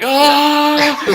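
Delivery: long, high, loud falling scream; drawn-out mock wail as if in slow motion. Gen plunging into the pond, slow-motion scream. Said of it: "This is slow motion".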